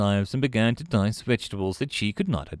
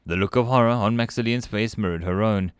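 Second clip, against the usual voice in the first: plain reading voice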